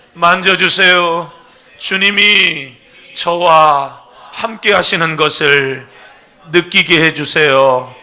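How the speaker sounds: loud, slow pleading prayer; fervent prayer. Shown as a demonstration. Pastor leading the church in prayer, fervent loud plea. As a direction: pray it out slowly for all to follow. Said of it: "따라서 하겠습니다".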